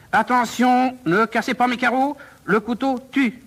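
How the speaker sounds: high, pressing warning; menacing teacher working up to a strike; urgent warning. Acted. Professor's urgent warning before stabbing.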